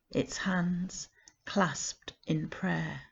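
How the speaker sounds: slow soft whisper; hushed ending. Acted. Closing the poem on the watch's death, whispered. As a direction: breathe the last line out. Poem's solemn last line.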